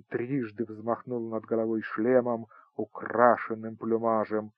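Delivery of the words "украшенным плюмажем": usual speaking voice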